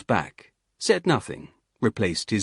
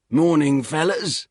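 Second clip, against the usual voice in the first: higher, louder cheery Cockney greeting; gravelly Cockney accent; 7 semitones higher, 5 dB louder than usual. Acted. Hiker greets the soldiers in a loud Cockney accent. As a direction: hail them in a rough Cockney voice. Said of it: "Lampshade said in a gravelly accent".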